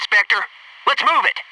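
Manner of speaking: normal talking voice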